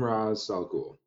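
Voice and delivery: Ra's said as "Rahz"; Raz pronunciation. Shown as a demonstration. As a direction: say Ra's with an open "ah" and a z. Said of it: "in Batman Begins, they call him".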